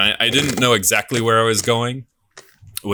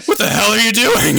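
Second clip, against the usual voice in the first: high, louder mock yell at the intruder; retold barman's yell; 13 semitones higher, 5 dB louder than usual. Barman yelling at a man wheeling a bike through the pub, mock shout. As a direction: bark it out like a startled barman. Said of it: "the man behind the bar yells".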